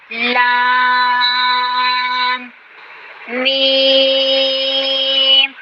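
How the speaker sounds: لام and میم held long and clear; drawn-out letters. Shown as a demonstration. Teacher demonstrating letters for students, held long. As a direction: stretch each letter name out slowly. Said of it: "میم کی آواز آپ نے ناک میں بالکل نہیں لے کے جانی".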